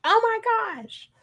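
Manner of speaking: imitating a stunned listener; amazed gasp. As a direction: stretch it out in stunned delight. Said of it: "And they were like".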